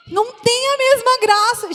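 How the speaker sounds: mock sulk. Wife whining to the congregation about half-eaten chocolate, high mock sulk. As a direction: pout it out like a let-down wife.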